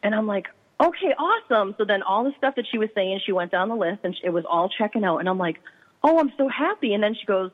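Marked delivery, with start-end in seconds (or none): 0.8-1.7 excited. Retold or imitated. Reacting to confirmation. 6.0-6.9 happy. Retold or imitated. Reacting to the reading checking out.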